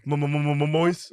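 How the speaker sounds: stuttering on the m; st-stutter the start of the word. Shown as a demonstration. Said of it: "a stutter is trying to say moist".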